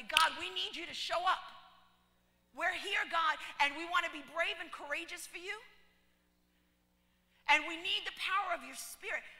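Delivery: loud, fervent preaching prayer. Acted. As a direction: plead with God at full force.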